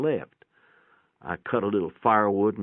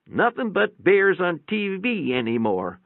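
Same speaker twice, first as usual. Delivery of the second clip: complaining nurse; 9 semitones higher than usual. A narrator's reading. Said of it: "the nurse complained".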